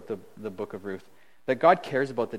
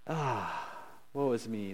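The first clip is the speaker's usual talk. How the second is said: softer, sighing mock woe; mock lament; 4 semitones lower, 8 dB quieter, slower than usual. Preacher mimics Naomi's self-pity, soft mock lament. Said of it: "Naomi going like".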